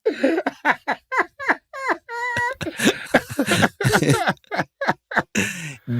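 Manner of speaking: high, wheezing cry-laugh; helpless laugh. Real, not acted. Cracking up at the wrong name with a high wheezing laugh. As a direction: laugh until the voice breaks.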